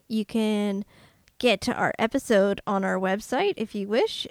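normal talking voice

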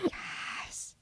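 breathless whispered yes. Real, not acted. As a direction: whisper it in awe. Whispered agreement.

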